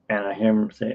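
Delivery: ordinary talk, nothing marked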